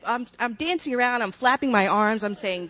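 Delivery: usual speaking voice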